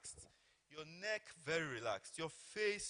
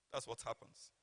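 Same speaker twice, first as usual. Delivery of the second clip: ordinary talk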